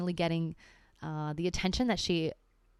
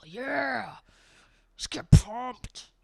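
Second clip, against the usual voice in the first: slow, loud hype yell; gym-hype shout; pumped shout; 5 semitones higher, 8 dB louder, much slower than usual. Real, not acted. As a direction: pump yourself up. Hyping herself up.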